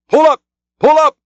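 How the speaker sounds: high, loud urgent order; cried. A narrator's reading. Stubb driving the boat close to the whale, loud cry. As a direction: bark it at the rowers. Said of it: "he now cried".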